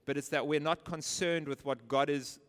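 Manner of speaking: usual speaking voice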